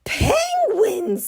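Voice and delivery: hammed-up Penguins!; amazed exclamation. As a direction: gasp it out in mock wonder. Tacky's exaggerated exclamation.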